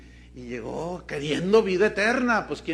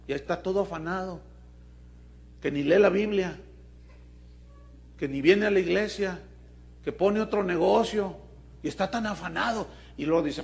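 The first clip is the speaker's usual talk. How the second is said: ordinary talk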